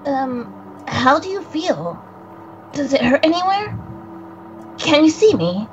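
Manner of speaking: lightly voiced young boy, nervous and guilty; a hint of a jittery spirit boy who fears he killed someone; young boy character. Friend voicing the visual novel's boy, lightly nervous. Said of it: "Coco, please, please take it away".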